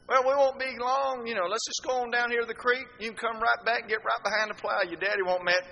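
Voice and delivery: high, loud coaxing; coaxing buddies. Retold or imitated. Buddies coaxing Dad away from the plow, high and loud. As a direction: talk him into it, up high.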